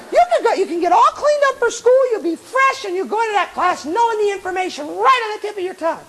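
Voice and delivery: high-pitched eager persuasion; overeager inner voice. Acted. Little voice promising a student success in class, overeager. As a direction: sell it high and keen all the way through. Little voice keeps selling sleep.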